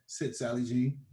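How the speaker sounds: ordinary delivery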